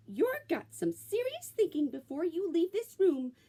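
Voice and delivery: high-pitched scolding mama; scolding mother. A narrator's reading. Mother scolding her son about birds, high voice. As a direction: scold him like an exasperated mother. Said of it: "said his mother".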